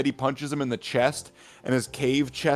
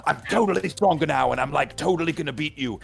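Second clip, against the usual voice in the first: cocky bragging; 3 semitones higher, faster than usual. Retold or imitated. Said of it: "he's like".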